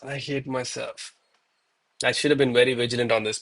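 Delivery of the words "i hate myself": quiet self-loathing. Real, not acted. Self-blame after missed trade.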